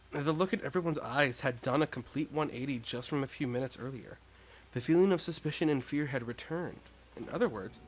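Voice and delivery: ordinary delivery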